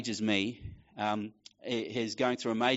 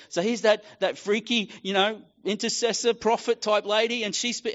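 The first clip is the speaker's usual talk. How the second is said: much higher, raised excited telling; excited friend; 10 semitones higher, 4 dB louder than usual. Retold or imitated. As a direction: gush about it, pumped. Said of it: "He said".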